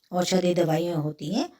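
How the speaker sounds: ordinary delivery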